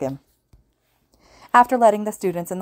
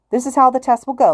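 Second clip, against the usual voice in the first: higher, louder radio host voice; loud announcer; 7 semitones higher, 7 dB louder than usual. A narrator's reading. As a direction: announce it to the hall. Hero explains the test.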